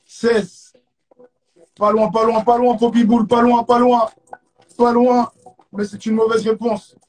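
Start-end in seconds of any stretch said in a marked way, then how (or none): 1.8-5.3 loud, hyped game-show teasing; loud buzzing host energy; excited near-miss. Real, not acted. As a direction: rub it in that they were close.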